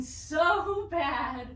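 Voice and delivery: strained, shaky laughing voice; shaking with effort. Real, not acted. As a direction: arms trembling mid-exercise. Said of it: "My arms are shaking".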